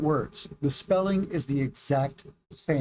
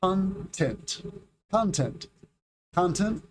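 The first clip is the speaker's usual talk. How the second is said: CONtent, each time stressed on CON; "content" as CON-tent, said separately for learners; stress on first syllable; 3 semitones higher, slower than usual. Shown as a demonstration. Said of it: "stress the first syllable".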